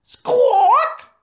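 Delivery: shrill loud bird screech; terrifying squawk. A narrator's reading. Narrator voicing the bird's terrifying squawk. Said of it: "terrifying squawk".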